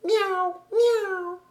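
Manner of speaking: high, drawn little mews; kitten meows. A narrator's reading. Kittens interrupt Heidi's explanation, high meows. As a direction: mew like two hidden kittens.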